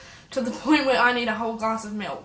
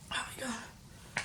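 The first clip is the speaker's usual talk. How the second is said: slow, barely voiced whisper; faint breathy aside; pained whisper; 16 dB quieter, much slower than usual. Real, not acted. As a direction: whisper it weakly to yourself, still burning. Still suffering from chili during outro.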